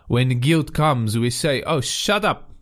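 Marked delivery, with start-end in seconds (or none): 1.6-2.5 high, breezy brush-off; a mock-annoyed shut up; dismissive. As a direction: wave guilt away like a pest. Dismissing guilt's voice.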